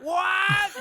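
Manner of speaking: very high, loud shriek of "what"; audible disbelief. Retold or imitated. Viewer reenacts seeing her turn into a dove, shocked shout. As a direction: reenact a stunned outburst, full volume. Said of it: "I audibly went".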